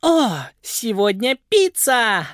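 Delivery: high, slow, thrilled cry; delighted squeal. A narrator's reading. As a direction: squeal with joy at the pizza. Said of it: "обрадовалась Виола". (радостный возглас)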